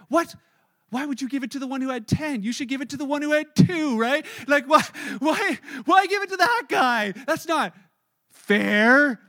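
outraged protest. Retold or imitated. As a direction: yell that it's not fair. Listeners outraged at unfairness.

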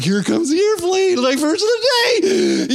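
high, sing-song coaxing; airplane spoon-feeding voice. Acted. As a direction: sell it like a spoonful for a baby. Same airplane game, with verse.

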